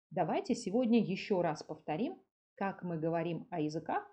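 usual speaking voice

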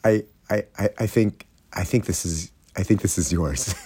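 soft, low, stammering mock contrition; sheepish. Fan handing the ball back to her, sheepish. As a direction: hesitate and shrink into a sheepish offer. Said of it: "he very sheepishly hands, hands the ball".

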